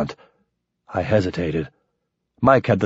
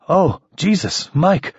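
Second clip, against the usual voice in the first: shocked; 9 semitones higher than usual. Acted. Sees friend bleeding.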